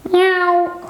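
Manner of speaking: high, long cat meow. Pretend. Teacher imitating a cat for pupils, cat meow. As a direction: mew like a cat.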